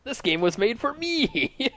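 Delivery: high, slow, playfully thrilled; mock delight. Playfully finishes friend's excited thought.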